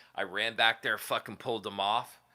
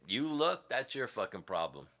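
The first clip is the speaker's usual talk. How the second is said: normal talking voice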